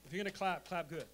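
loud, ringing call to the crowd; rousing call. Real, not acted. Preacher urging the congregation to clap, loud and rousing. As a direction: rouse the room to clap.

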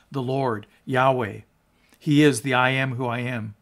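ordinary delivery

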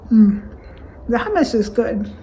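eating and speaking; talking while chewing. Real, not acted. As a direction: mouth partly full. Seen 2: bites food at -1.0, mouth working and hand wiping at start.

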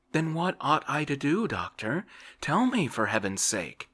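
startled plea. A narrator's reading. Frightened patient begging his doctor, startled plea. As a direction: beg the doctor in sudden alarm. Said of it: "Oblomov started".

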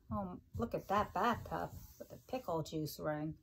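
ordinary delivery

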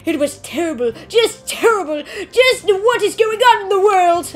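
put-on old man lamenting the news to the student. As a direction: ham up a worried old man. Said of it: "my old man voice".

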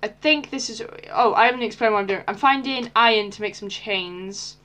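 ordinary delivery

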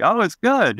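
lightly voiced dad coaxing. Dad coaxing his kid that the tuna is good, high cajoling.

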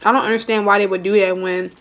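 speaking between bites; talking while chewing. Real, not acted. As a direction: mouth partly full of food. Seen 4: Closed, pursed lips while speaking after bites; fork and food in hand.

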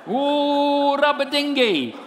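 high, loud wailing tongues parody; mock Pentecostal. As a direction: whoop it like hammed-up ecstatic prayer. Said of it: "I didn't go all Pentecostal".

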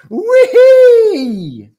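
very high, loud "whee"; long squeal of glee; gleeful squeal. A narrator's reading. Yak thrilled at the news, gleeful squeal.

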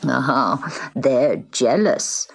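put-on low, slow old man's knowing remark; a lightly voiced old man, amused. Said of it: "said the little old man".

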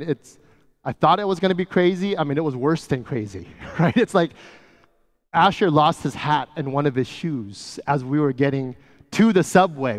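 usual speaking voice

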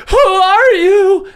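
high, slow, loud startled question; wide-eyed prisoner asking; shocked miner. Acted. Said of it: "The two next to you are like".

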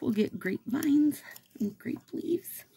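high, breathy bird whisper. Pretend. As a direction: whisper it high like a little songbird. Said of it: "They're like".

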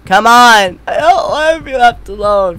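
loud, pleading yell; frustrated shout. Real, not acted. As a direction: call out, fed up. Shouting for the shop staff.

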